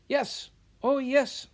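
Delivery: high, loud, slow eager yes. Acted. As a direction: insist with breathless eagerness. Eager apprentice insisting.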